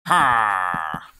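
loud, drawn-out "ha"; one forceful creature call; Pillager impression. Shown as a demonstration. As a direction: imitate a game monster's noise. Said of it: "Pillager impression".

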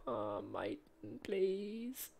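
high, soft hammed-up begging; mock pleading. Gamer begging his striker to score, mock pleading. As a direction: beg the player like a desperate servant.

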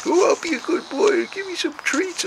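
very high squeaky pleading cat voice; voicing the cat. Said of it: "And he's like".

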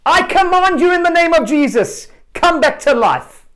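high loud command, slowing on come back to life; commanding shout. Retold or imitated. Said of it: "he shouts".

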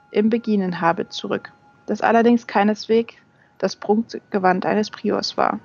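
usual speaking voice

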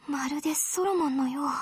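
low, soft, almost to herself; hushed. Acted. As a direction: murmur the memory quietly. Same line, hushed.

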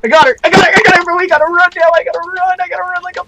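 frantic, fast and high; panicked scramble to escape; panicked rushing. Real, not acted.

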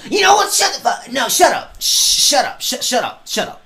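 shouting shut up repeatedly; angry yelling. Real, not acted. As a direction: yell angrily at the screen. Yelling at the game king.

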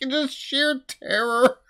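ordinary talk, nothing marked

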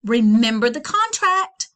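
loud stern call; mother shouting. Acted. Mother reminding Flora of the contract, loud shout. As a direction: bellow the reminder. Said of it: "her mother shouted".

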